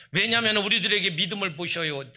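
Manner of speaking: booming pulpit declaration; preaching loudly. Real, not acted. Preacher booming to the congregation, loud. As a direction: push it out to the whole church.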